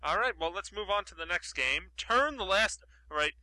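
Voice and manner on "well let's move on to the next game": usual speaking voice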